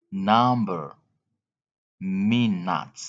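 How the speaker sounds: usual speaking voice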